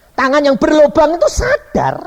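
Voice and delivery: high, loud insistence; full-force preaching. Real, not acted. Preacher testifies to the pierced hand, loud and high. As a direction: proclaim it forcefully.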